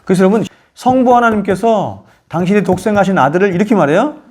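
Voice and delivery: normal talking voice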